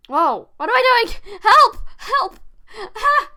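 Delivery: sudden loud cries for help; startled, shouting for help; panicked yelling. Real, not acted. Startled by something on screen.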